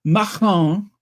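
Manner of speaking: Macron said the French way, stress on the last syllable; a light French accent on Macron. Shown as a demonstration. Vlogger repeats the name in her French accent. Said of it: "that's my French accent".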